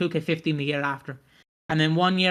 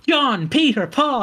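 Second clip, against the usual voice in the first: raised, louder name roll call; granddad listing names; 8 semitones higher, 5 dB louder, slower than usual. Retold or imitated. Granddad slapping his grandson's head, mock name calling. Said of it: "but still fucking".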